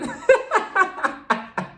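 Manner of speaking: high airy mocking giggle. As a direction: end the parody on a silly giggle. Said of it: "she's like".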